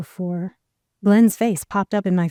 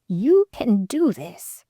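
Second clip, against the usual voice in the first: spirit voice; slower than usual. A narrator's reading. Spirit voice encourages her.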